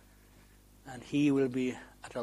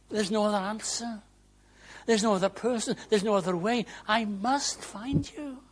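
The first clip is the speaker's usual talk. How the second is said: high, urgent pleading; earnest plea; 9 semitones higher, 4 dB louder than usual. Acted. Preacher voicing a seeker's prayer to the Lord, high earnest plea. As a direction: plead as if there is no other hope. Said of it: "We need to be earnest".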